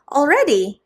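surprised. Acted. Surprised question.

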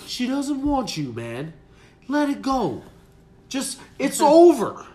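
imitated dad, building to a loud shout; angry dad. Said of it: "This time her dad answers".

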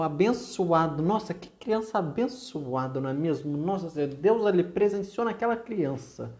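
high-pitched ironic gushing; mock admiration for an annoying child. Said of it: "você usa da ironia".

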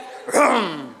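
vocal muscle-car rumble; imitated engine roar dying away; engine rev imitation. Storyteller imitating brother's Camaro pulling up, engine rev.

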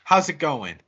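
pissed off. Retold or imitated. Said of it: "And he is pissed".

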